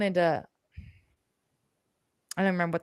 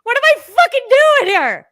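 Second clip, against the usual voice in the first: panicked self-talk; 13 dB louder, slower than usual. Retold or imitated. Retelling panic on the road.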